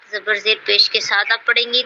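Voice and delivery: normal talking voice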